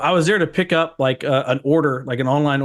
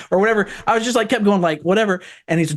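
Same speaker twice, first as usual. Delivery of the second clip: usual speaking voice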